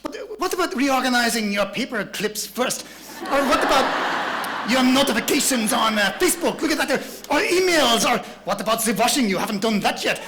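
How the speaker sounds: divert them busily in mock-French accent; distractor's French accent. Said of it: "I don't know why they have accents".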